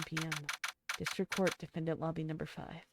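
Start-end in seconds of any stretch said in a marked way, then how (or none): none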